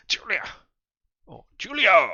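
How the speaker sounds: loud call, high and sharp. Acted. Kadir calling Julia to stop. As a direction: call her name across the room.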